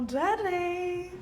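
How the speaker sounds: slow teasing Oh, Daddy; drawn-out Daddy. Shown as a demonstration. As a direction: stretch out Daddy, playful and slow. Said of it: "Go again".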